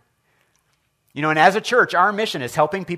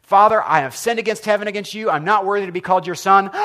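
raised, urgent confession to the father; pleading apology; 5 semitones higher, 6 dB louder than usual. Retold or imitated. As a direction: blurt it out louder, pleading. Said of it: "And the son says".

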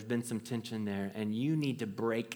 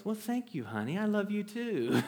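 touched mom; 8 semitones higher than usual. Retold or imitated. Mom replies on phone.

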